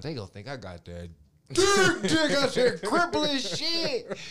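loud high slow laughing boast; bragging through laughter; laughing brag. Retold or imitated.

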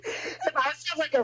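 high laugh spilling into words; burst of laughter. Real, not acted. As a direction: laugh out at the absurd idea. Laughing at rap beef idea.

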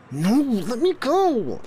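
put-on high bratty boy voice; whining little boy. A narrator's reading. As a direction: squirm and protest like a kid. Child squirming in arms.

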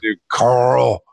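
imitating Rick's Georgia accent; a hint of Georgia Southern accent calling Carl; mock Georgia drawl. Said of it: "his shitty Georgian accent".

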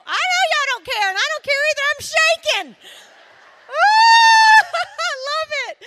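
thrilled and shaking. Real, not acted. Speaker shaking with excitement before the ladies, whooping loud. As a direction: gush and whoop with joy.

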